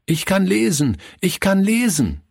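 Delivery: put-on high, excited boy's voice; jubilant calling out. A narrator's reading. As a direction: proudly call it out to everyone, pitched high. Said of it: "rief er jedem zu". (jubelnd und hoch gerufen)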